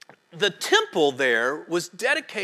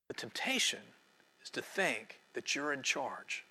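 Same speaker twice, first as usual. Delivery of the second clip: whisper; 7 semitones lower, 13 dB quieter than usual. Real, not acted. Solemn point in sermon.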